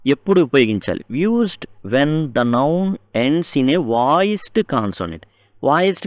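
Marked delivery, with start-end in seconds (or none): 1.1-5.2 very slow, word by word rule reading; slow dictation. Shown as a demonstration. Teacher dictating the voiced consonant rule, very slow. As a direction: spell out the rule slowly for the class.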